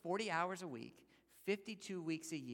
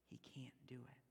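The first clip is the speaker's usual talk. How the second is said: whisper; 4 semitones lower, 14 dB quieter than usual. Real, not acted. Aside on the hopeless debt.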